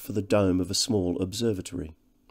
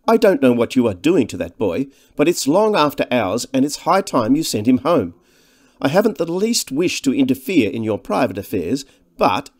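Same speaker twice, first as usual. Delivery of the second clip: louder, higher, insistent protest; indignant remonstrance; 4 semitones higher, 6 dB louder than usual. A narrator's reading. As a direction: insist politely but firmly. Ransom remonstrates with the two men.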